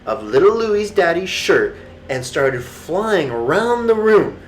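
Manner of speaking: usual speaking voice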